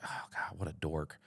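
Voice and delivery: whispered aside to himself; inner thought. Retold or imitated. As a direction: breathe it like a private thought. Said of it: "That's what I'm thinking in my brain".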